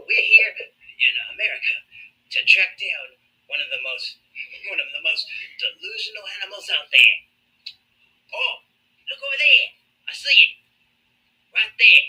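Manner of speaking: lightly Australian nature-show voice; Australian wildlife host. Retold or imitated. An Aussie wildlife host tracking magas in a light Australian accent. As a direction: play a wildlife documentary host. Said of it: "They're like".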